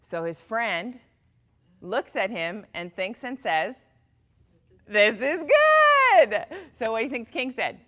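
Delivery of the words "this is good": much higher, loud and very slow, each word stretched; cheerful shout. Acted. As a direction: shout the motto out joyfully. Friend answering after the accident.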